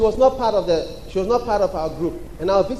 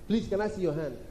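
normal talking voice

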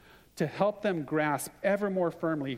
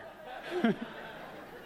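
high airy laugh; breathy laugh; 11 semitones higher, 6 dB quieter than usual. Real, not acted. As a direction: laugh it out softly. Laughs at the story.

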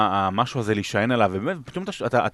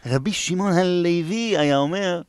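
silly high voice; 10 semitones higher than usual. Pretend. Funny greeting voice for Asaf.

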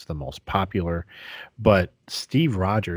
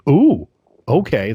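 louder, higher pleased ooh; delighted actor; 6 semitones higher, 8 dB louder, slower than usual. Retold or imitated. Actor offered the Captain America role, thrilled. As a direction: react like an actor hearing great news. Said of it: "And you're like".

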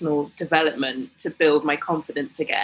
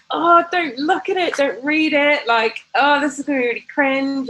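high, embarrassed pleading; coy; 8 semitones higher than usual. Retold or imitated. Showing her husband the blog, high embarrassed coy. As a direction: beg him not to read it, coyly. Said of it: "I just remember being so coy".

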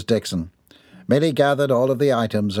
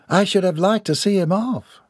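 higher, wistful Southern lady; 9 semitones higher than usual. Acted. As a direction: sigh it out as a regretful mother figure. Voices lady in story.

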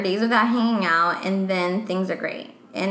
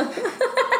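teasing high no; 13 semitones higher than usual. Acted. Joking check yes or no.

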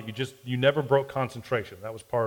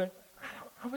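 embarrassed whisper; 10 semitones higher, 17 dB quieter than usual. Real, not acted. Embarrassed, voice nearly gone.

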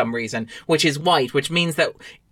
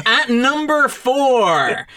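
drawn-out, raised chart-show announcement; countdown announcer; 7 semitones higher, 3 dB louder, much slower than usual. Pretend. Host unveiling a chart entry to listeners, countdown announcer.

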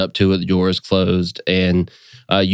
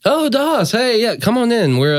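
much higher, bright welcoming sister; sister's voice. Acted. Sister welcoming her brother into her office, much higher. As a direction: greet your brother happily, high. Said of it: "voice of your sister".

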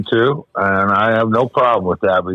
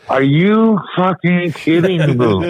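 high, slow disbelieving outburst; panicking gamer; 7 semitones higher, slower than usual. Retold or imitated. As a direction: relive the shock of the worst play. Reliving a painful football play.

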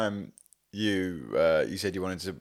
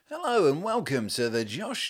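high, slow, hammed-up announcer voice; mock radio presenter; 7 semitones higher, slower than usual. Mocking the host's polished intro as a cheesy presenter. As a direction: send up his intro like a radio host. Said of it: "Is that how I speak".

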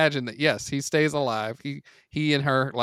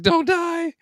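put-on very high, loud sobbing girl voice; hammed-up falsetto pleading; mock crying girl; 6 dB louder than usual. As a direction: wail for your dying brother. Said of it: "she's like".

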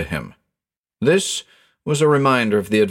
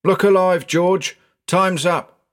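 driver calling out; 9 semitones higher, slower than usual. Acted. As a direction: call out to hurry him along. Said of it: "there came a call from the driver".

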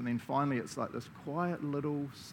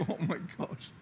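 mock awe; 4 semitones higher, 4 dB quieter than usual. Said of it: "I'm like".

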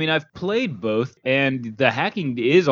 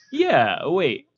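ordinary talk, nothing marked

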